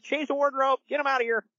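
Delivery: high-pitched mock director on a bullhorn; imitated bullhorn call. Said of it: "he, like, gets on the bullhorn".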